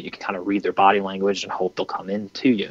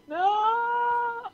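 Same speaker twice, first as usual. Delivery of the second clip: very high, cracked "No!"; hunter's high-pitched mock wail; anguished no; 4 dB quieter than usual. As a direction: squeal it in mock dismay. Buck seems to run off.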